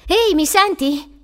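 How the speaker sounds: high, loud, slow call. Acted. Woman checking on boy behind door, calling out.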